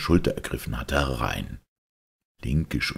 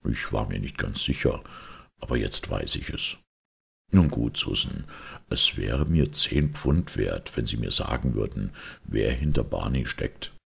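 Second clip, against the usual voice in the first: low, unhurried detective voice; Holmes; 4 semitones lower than usual. Acted. Detective offers ten pounds for a name, low and cool. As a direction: coax coolly in a deep, measured voice.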